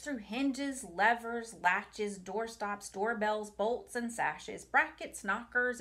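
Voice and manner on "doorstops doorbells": plain reading voice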